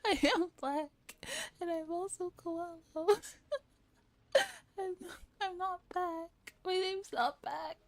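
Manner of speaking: high, squeaky laughing fit; laughing while talking. Real, not acted. Cracking up over a misheard "I'm back", high laughing voice. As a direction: squeeze the words out through giggles.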